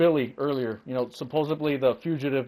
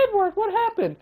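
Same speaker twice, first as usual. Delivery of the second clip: mock-nonchalant falsetto impression; very high, airheaded girlish mimicry; Rebecca. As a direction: mimic a woman far too unbothered, in falsetto. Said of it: "Rebecca acted so calm".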